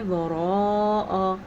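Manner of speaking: وراء drawn out slowly with no extra aa; correct recitation. Shown as a demonstration. Teacher reciting وراء slowly for students. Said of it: "آ ذرا بھی نہیں کرنا".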